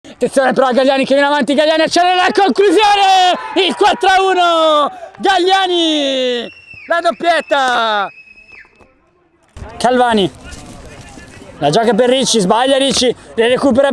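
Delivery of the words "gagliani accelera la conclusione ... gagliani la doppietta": goal shout. Real, not acted. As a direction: shout the scorer slowly. Goal called live.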